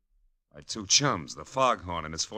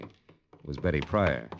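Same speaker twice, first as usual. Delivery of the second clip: normal talking voice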